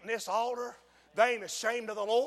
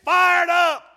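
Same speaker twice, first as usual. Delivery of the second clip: coach yelling; 9 semitones higher, 11 dB louder than usual. Retold or imitated. Bloodied coach yells at his team to fire up. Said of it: "He said".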